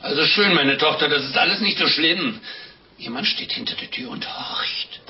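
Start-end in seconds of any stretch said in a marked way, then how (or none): 3.0-5.0 a high, hushed warning whisper; urgent whisper. Acted. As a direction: whisper so the man at the door can't hear. Priest warns of eavesdropping husband. (dringendes Flüstern)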